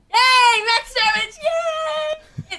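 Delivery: very high, loud squealed cheer; excited cheering. Real, not acted. Player cheering a top damage roll, thrilled squeal. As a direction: celebrate with a real high-pitched whoop.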